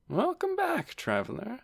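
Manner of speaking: high, soft greeting; doctor aunt. A narrator's reading. Doctor greets her nephew.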